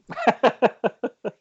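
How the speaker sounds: high, hammed-up laughing fit; mock uproarious laugh. Narcissist writer laughing at his own writing, high hammed-up laugh. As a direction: cackle at your own brilliance. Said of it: "who's laughing out loud uproariously".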